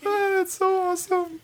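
very high delighted squeak; tiny squeaky voice; high squeal. Real, not acted. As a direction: react in a squeaky, high-pitched voice. Reacting during the helium round.

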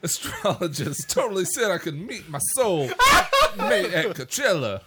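high, halting accent attempt veering off Boston; hammed-up, wobbly Boston accent with odd breaks; botched Boston accent. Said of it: "That turned into Christopher Walken".

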